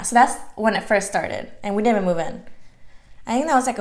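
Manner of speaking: normal talking voice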